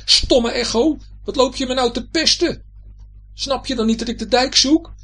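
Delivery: high, loud, exasperated scolding; cross high-pitched yelling; angry shouting. A narrator's reading. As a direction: tell off the echo at the top of the voice. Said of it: "zo verschrikkelijk boos".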